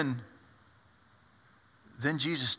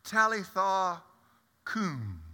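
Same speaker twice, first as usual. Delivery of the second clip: gently, fading away on "Talitha cum"; tender Aramaic call; 4 semitones higher, slower than usual. Acted. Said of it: "And he says".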